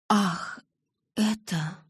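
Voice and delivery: ordinary delivery, nothing marked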